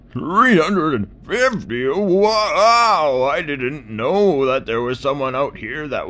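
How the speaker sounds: high, loud astonished tortoise; gasp, then gush with wonder; amazed old tortoise. Acted.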